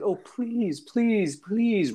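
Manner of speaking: high, imitated pleading; mock begging, pitched well up; mocking plea. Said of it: "he mocks himself saying".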